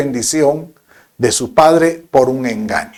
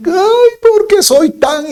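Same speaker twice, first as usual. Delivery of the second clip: much higher, slow whiny self-reproach; self-scolding wife; 5 dB louder, slower than usual. Retold or imitated. Wife scolding herself over the forgotten bag, high whiny wail. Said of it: "Y empieza así".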